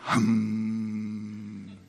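a flat, empty hum of nothing; zero's hum. Pretend. A zero's hum to show the company did nothing. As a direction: hum like a zero given a voice. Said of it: "That's the sound of a zero if it could have a voice".

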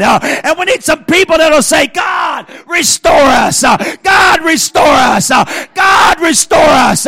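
high, loud, driving sermon shout; full-force preaching. Real, not acted. Preacher urges church to rise and cry restore us, shouted preaching. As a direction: preach it out with punched, rhythmic phrases.

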